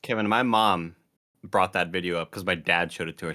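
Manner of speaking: ordinary delivery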